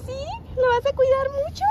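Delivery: mother asking her daughter about the new puppy, high and sing-song. Real, not acted. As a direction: gush at the little one with a high lilting voice. Talking to toddler about puppy.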